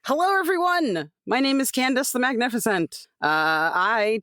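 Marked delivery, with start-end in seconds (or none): none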